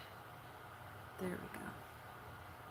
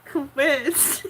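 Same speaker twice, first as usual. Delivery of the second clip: laughing protest; 10 semitones higher, 6 dB louder than usual. Real, not acted. Chat teasing her.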